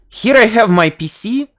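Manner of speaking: stilted Russian schoolroom English, reciting phrases; Russian school English. Shown as a demonstration. Demonstrating the third accent, Russian school English. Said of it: "with this, uh, school English".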